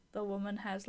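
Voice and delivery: ordinary talk, nothing marked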